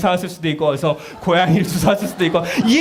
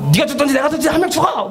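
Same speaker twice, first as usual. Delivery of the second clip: higher, fast mock outburst; mock desperate yell; 11 semitones higher, faster than usual. Parent shouting at child in despair, mock fast. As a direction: blurt it like a frantic parent.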